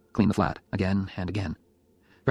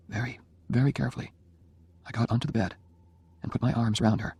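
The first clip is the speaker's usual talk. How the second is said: usual speaking voice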